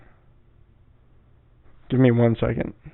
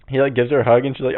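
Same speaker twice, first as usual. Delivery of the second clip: ordinary talk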